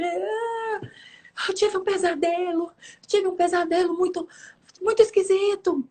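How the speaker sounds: high, whiny child imitation; crying little boy. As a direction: imitate a son whimpering to mom. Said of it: "O menino chorando, chorando". (imitando menino choroso, voz aguda)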